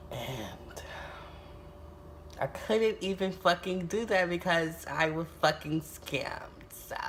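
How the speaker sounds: hushed, defeated whisper. Real, not acted. Telling viewers she couldn't help the girl, whispered. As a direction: breathe it out, crushed. Bitter end of scam story.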